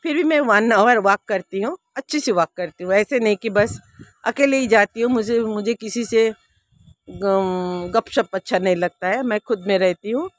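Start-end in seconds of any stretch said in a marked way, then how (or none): none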